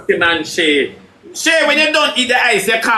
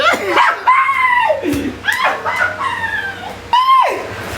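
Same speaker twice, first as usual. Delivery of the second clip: high squealing laugh. Real, not acted. Reveals outfit.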